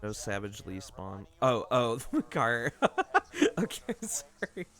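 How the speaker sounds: wired, laughing, bouncing energy; over-caffeinated giggly rush; hyper and giddy. Real, not acted. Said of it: "I'm fucking wired".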